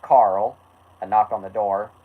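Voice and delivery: ordinary delivery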